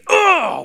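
loud high frustrated "ugh"; annoyed groan. Retold or imitated. Kids groaning when the snow day lands on a Saturday. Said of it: "we got so mad".

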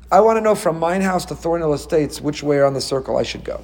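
usual speaking voice

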